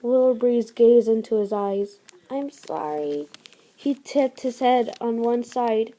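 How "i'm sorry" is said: low, soft, fading sorry; lower, much softer dying whisper; weak apology. Acted. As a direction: apologise with the last of her strength.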